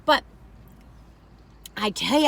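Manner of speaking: usual speaking voice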